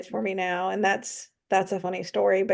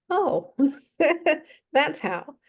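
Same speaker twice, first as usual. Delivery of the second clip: sudden realization; 7 semitones higher, slower than usual. Retold or imitated. Realizing narrator lived in Wilmington.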